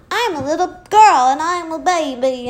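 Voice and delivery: high, loud baby-girl imitation; hammed-up child voice; mock little-girl voice. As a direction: exaggerate a babyish little girl, loudly. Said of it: "You don't have to talk like a child".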